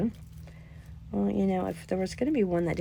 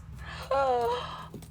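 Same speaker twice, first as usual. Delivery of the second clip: delighted gasp. Real, not acted. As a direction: gasp at a lovely find. Delighted find of cute earring.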